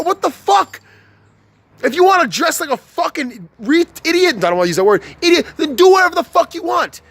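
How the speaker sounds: usual speaking voice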